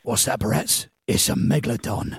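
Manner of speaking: low, rough tough-guy mutter; a lightly voiced action star; Jason Statham impression. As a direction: growl it like a movie hardman. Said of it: "Do Jason Statham".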